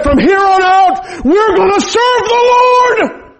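drawn-out preacher's cry of a dad's vow; retold shout. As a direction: bellow it like a father laying down the law. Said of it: "he's spitting and stomping and crying".

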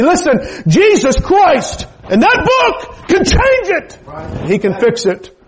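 high, loud, rising sermon climax; preaching at full force. Acted. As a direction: build the plea up to a ringing cry. Preaches to the congregation.

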